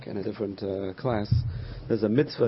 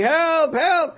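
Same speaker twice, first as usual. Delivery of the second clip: high, loud acted cry for help; screaming for help; 7 dB louder than usual. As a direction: imitate a woman screaming for rescue. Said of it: "he hears a woman screaming".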